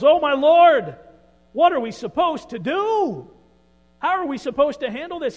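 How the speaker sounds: high, loud panicked shout; terrified yell. Acted. Servant sees the army surrounding them, terrified yell. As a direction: scream it out in sheer terror. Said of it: "the servant yells".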